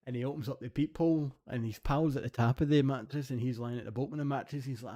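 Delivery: ordinary talk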